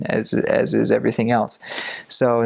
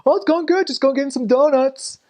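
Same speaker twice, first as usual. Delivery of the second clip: very high, hammed-up cheerful reply; chummy cops. Cops replying to missionary around town, exaggeratedly cheery. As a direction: answer as comically friendly cops. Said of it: "They're like".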